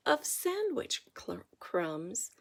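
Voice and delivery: ordinary delivery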